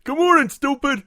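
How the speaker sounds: high loud mocking shout; mock yell. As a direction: yell it like a cartoon jab. Mocking jab after being called stupid.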